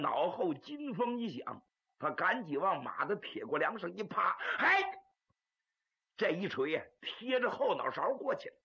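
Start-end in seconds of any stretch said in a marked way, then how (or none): 4.6-4.8 short high "hey" of effort; exertion cry. Acted. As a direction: cry out while ducking a blow. Fighter's cry while ducking.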